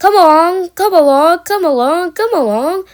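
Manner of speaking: high, loud rhythmic urging, easing off; puffing engine. A narrator's reading. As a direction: chug the words like a pulling engine. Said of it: "he puffed".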